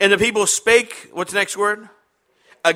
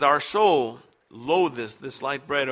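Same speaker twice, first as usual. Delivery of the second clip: slow, lower, solemn King James reading; solemn scripture reading; 6 semitones lower, 3 dB quieter, slower than usual. Shown as a demonstration. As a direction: intone the old verse gravely. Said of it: "notice it says".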